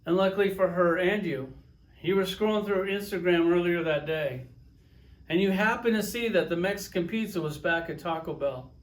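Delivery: usual speaking voice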